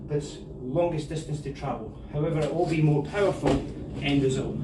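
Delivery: normal talking voice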